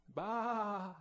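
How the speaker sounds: short comic baa like a startled sheep; a quick hammed-up baa; mock sheep bleat. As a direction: let out one silly sheep bleat. Sheep's reaction to the punchline.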